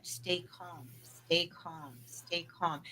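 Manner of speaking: a quiet voice inside the head; hushed inner voice. Retold or imitated. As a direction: murmur it like a voice in your head.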